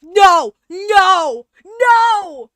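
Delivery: loud repeated no; shouting no. Real, not acted. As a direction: yell it in dismay. Reacting in horror to game.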